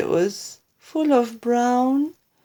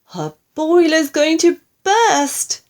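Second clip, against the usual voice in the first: high, loud alarm; wailing; 8 semitones higher, 7 dB louder than usual. A narrator's reading. Wife warning grandma will burst, high wailing panic. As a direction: wail it as disaster looms. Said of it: "Missus Cranky wailed".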